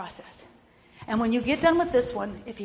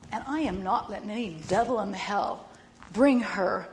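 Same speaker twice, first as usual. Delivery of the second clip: ordinary talk, nothing marked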